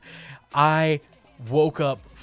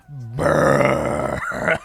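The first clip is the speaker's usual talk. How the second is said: long, drawn-out mock belch; imitated loud burp; 5 dB louder than usual. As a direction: imitate a huge rolling burp. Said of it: "he lets out the loudest burp".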